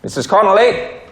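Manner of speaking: high questioning call, rising at the end. Acted. Visitor calling for the lady of the house, calling out. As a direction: call out to see if anyone's there.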